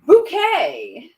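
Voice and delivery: "bouquet" as a high, loud boo-KAY; affected pronunciation. Acted. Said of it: "she pronounced it".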